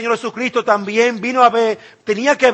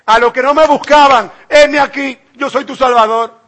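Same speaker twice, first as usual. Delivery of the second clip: proclaiming God; 4 semitones higher, 6 dB louder than usual. Acted. Preacher voices God revealing himself.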